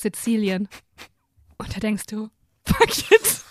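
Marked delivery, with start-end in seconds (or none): 2.6-3.4 sudden high exclamation. Retold or imitated. Decides to go to Sicily.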